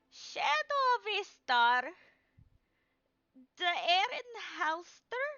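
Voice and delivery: put-on high, slow old crone calling names; an old seer greeting familiar faces; old fortune teller. GM voices Madame Eva greeting.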